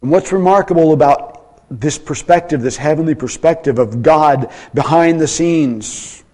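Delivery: loud, high, driving sermon voice; preaching at full force. Acted.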